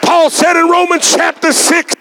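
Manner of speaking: rising sermon delivery with slow drawn-out peaks; preaching. Acted. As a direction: preach it, stretching the key words.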